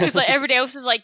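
normal talking voice

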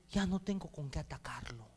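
a beaten devil whispering his defeat; whispered Satan. Retold or imitated. Pastor voicing a defeated Satan, whispering.

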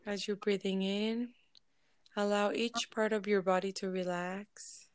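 unhurried, measured relaxation voice with lingering silences; guided meditation. Real, not acted. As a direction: ease listeners into stillness, phrase by phrase. Leads a group meditation.

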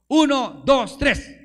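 counting one two three loud and high to cue the crowd; preacher shouting the count so the church cheers. Shown as a demonstration. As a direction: call the count out to the congregation. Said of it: "me van a dar ustedes ... un fuerte grito de júbilo".

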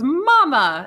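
high, loud, drawn-out mock greeting; hammed-up affectionate call to a mother-in-law; imitated gushing greeting. Diana greets Queen with a hug.

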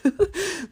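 short high laugh, breaking out; real burst of laughter. Real, not acted. Vlogger laughing at the image of tomatoes thrown at her. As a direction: laugh out loud at the thought.

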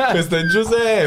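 high, laughing presenter voice; laughing mock intro.

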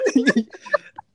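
high laugh bursting out; can't stop laughing; laughing fit. Real, not acted. Keeps laughing. (tertawa lepas)

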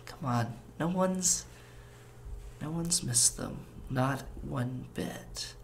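a soft, slow, creeping temptation; tempting beast. Acted. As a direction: lure them in softly, like a hungry beast.

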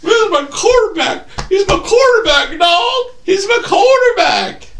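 very high weepy falsetto; hammed-up crying squeal; acting tearful loyalty. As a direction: blubber in defence of your teammate. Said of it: "I'm acting it".

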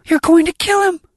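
very high, loud scream; piercing, desperate shout; yell. A narrator's reading. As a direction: scream a frantic warning. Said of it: "I yell at him".

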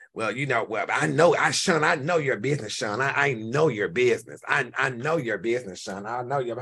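a hint of a snide rival, drag out I know your business; mocking her rival. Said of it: "so she says".

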